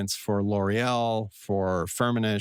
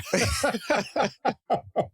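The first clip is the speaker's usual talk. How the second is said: laughing; 13 semitones higher than usual. Real, not acted. Laughs at own tangent.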